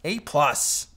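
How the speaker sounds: curt demand with mock aggression. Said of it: "like, straight aggression there".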